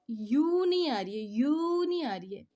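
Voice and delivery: "यू" held long as a pure "yooo"; rejected vowel sound. Shown as a demonstration. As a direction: exaggerate and stretch "यू" as the wrong sound. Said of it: "ज".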